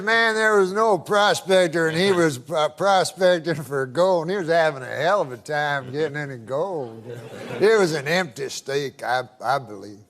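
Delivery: lightly imitated good old boy, low; a hint of low, folksy country storyteller. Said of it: "That's how he talks".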